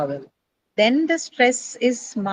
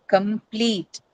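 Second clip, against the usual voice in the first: "complete" slowly, leaning on long -plete; teacher's slow demonstration of "complete", stressed on -plete; stress on second syllable. Shown as a demonstration. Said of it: "stress to shift from the first syllable to the second".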